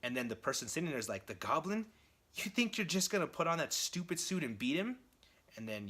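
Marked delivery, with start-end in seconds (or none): 1.3-5.0 high-pitched, acted incredulous questioning; incredulous scoff. Captive to Norman putting on the Goblin costume, incredulous. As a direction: scoff in disbelief at the very idea.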